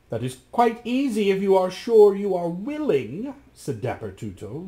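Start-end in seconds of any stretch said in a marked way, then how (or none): 0.1-3.3 higher, a voice unlike the narrator's; pleased magician. Acted. The magician tells Rasmus it is easy, sounding pleased. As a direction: sound sly and pleased with yourself. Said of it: "looking very pleased".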